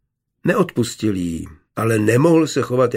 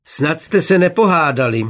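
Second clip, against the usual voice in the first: higher, louder call from the balcony; 6 semitones higher, 5 dB louder than usual. A narrator's reading. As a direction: call down across the yard. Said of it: "křikla na něj z pavlače". (volání z pavlače, vyšší a hlasitější)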